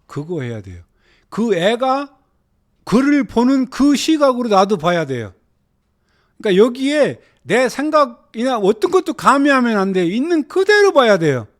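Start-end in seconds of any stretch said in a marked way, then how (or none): 1.3-11.5 loud, high-pitched exhortation; urgent raised teaching voice; emphatic preaching. Real, not acted. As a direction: preach it forcefully, phrase by phrase. Exhorts the class.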